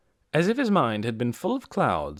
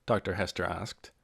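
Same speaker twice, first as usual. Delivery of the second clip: ordinary delivery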